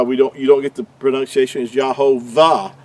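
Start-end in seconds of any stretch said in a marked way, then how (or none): 1.7-2.7 "Yahovah" with a V sound before the last vowel; mispronounced with V. Shown as a demonstration. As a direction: put a clear V in "Yahovah". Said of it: "It's not a V, it's a oo sound".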